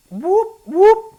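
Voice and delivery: high loud siren whoop; police siren imitation. Shown as a demonstration. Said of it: "That's the sound of the police".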